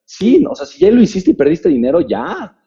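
scoffing critics. Retold or imitated. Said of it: "La gente me dijo".

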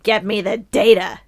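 loud barking newspaper boss; bossy editor shout. Acted. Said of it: "if I was Jameson".

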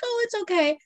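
high-pitched soothing coo, acted; comforting parent. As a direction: imitate soothing a hurt child. Parent comforting hurt kid, retold.

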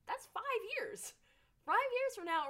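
high, loud and slow; excited exclaim. Real, not acted. Host reacting to space hotel news, high excited disbelief. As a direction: stretch it out in disbelief.

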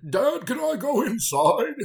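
very high, loud, slow put-on old English voice; a child sounding like his old father; same old man voice. Son asking his dad, in the same odd old voice. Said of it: "they had the exact same voice".

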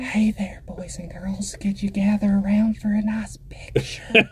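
creepy high whisper; horror host. Retold or imitated. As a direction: breathe it like a spooky TV host.

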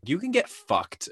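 slow, high-pitched emphatic dismissal; mock emphatic brush-off, pitched well up; with gumption. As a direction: imitate a friend's gutsy put-down, drawn out. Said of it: "you just said it in this way that was so".

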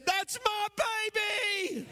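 high-pitched, drawn-out whoop, quoting his wife; retold shout of pride from the crowd; imitated proud cheer.

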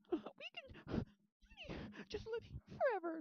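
very high, put-on pleading voice; lovesick bot. Bot begging Junie to stay, lovesick. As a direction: plead in a squeaky mock-romantic voice. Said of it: "developing emotions".

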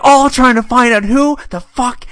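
high, loud, drawn-out indignation; mock outrage. Hot dog suit man at the crash, mock outrage. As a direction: demand it slowly and loudly, hammed-up outrage.

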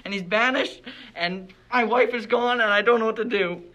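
loud, anguished outburst of grief; distraught. Acted. Montague tells the podcast host about his banished son and lost wife, distraught. Acted grieving father breaking down.

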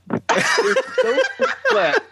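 cracking up while repeating it; laughing. Real, not acted. Telling friends about sweaty wrestling coworkers, laughing.

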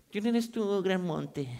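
soft, half-hearted challenge; feeble, unconvincing. Shown as a demonstration. Preacher mimicking a timid congregation facing the mountain, feeble. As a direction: say it weakly, with no force behind it. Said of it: "Ay, sí, ya menos se va a mover".

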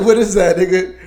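put-on cracking up in disbelief at the accent; laughing disbelief.

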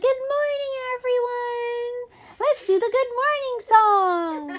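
high, slow sing-song teacher greeting. Retold or imitated. Kindergarten teacher greeting the class, high sing-song. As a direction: greet the little ones like a cheery kindergarten teacher.

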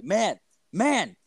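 high, mock calling-out; retold yell back. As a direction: call through a door without real volume. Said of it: "And so I yell back".

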